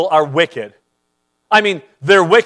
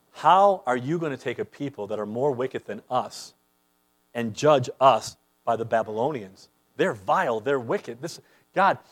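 hushed troubled prophet; 6 semitones lower, 6 dB quieter than usual. Acted. Habakkuk voiced, troubled, protesting quietly.

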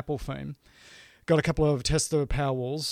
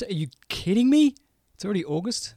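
higher, louder mock disbelief; surprised; 6 semitones higher, 4 dB louder than usual. Real, not acted. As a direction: exclaim it in shock. Shocked it's already August.

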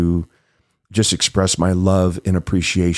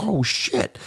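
panicked; 8 semitones higher, 5 dB quieter than usual. Retold or imitated. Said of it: "And I'm like".

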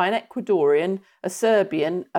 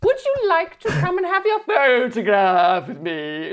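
posh nanny voice; 5 semitones higher than usual. Retold or imitated. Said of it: "And you're like".

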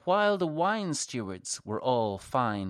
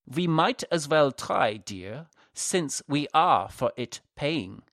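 hammed-up German accent, louder; a wink to his girlfriend in exaggerated German accent; 3 semitones higher, 3 dB louder than usual. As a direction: play up a jokey German accent. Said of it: "in a very exaggerated German accent said".

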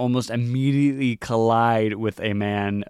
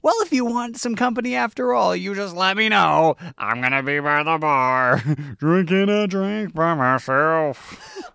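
put-on stable boy voice; 8 semitones higher, 3 dB louder than usual. Stable boy offers Mari company in a high, scratchy voice. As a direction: play a lonely, hopeful boy. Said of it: "he's got a little bit of scratch in his voice".

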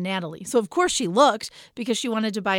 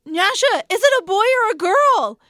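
much higher, louder demanding call; urgent calling out; 12 semitones higher, 8 dB louder than usual. Retold or imitated. Mother in labor bed calling to her husband, high loud demand. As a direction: call across the room to get an answer.

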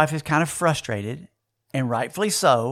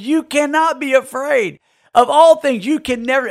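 scolding wife; 9 semitones higher, 5 dB louder than usual. Retold or imitated. Wife scolding on shaking plane.

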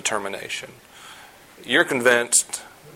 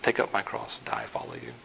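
dreading whisper-soft quote; 12 dB quieter than usual. Pretend. Quoting a listener's dread.

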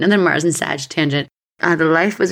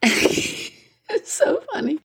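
much higher, slow, laughing through the words; real giggle at the memory; laughing at the guide's reading; 13 semitones higher, 4 dB quieter, much slower than usual. Real, not acted. Laughing at her own story.

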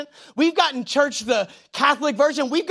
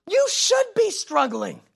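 slower emphatic repeat; 8 semitones higher, slower than usual. Real, not acted.

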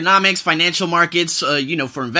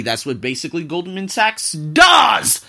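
slow, shouted emphasis; emphatic; slower than usual. Real, not acted. Host hammering his point to listeners, slow and shouted. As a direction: lean hard on the whole line. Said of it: "I had to emphasize that".

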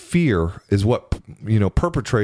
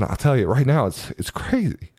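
ordinary delivery

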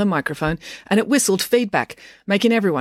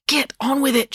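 higher, insistent prod; urging; 8 semitones higher than usual. A narrator's reading. Mayor pokes Dad to continue, urging. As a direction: press him to continue. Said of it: "she urged".

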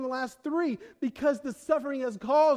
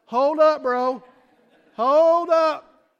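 loud, higher call to halt, hold up bro; 5 semitones higher, 12 dB louder, much slower than usual. Retold or imitated. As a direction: throw up a verbal stop sign, loudly. Pastor stopping man from forcing faith.

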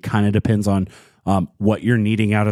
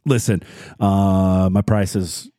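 ordinary delivery

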